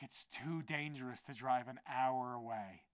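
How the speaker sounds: soft, subdued protest; deflated plea. Retold or imitated. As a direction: say it quietly, let down by the refusal. Retold deflated plea after refusal.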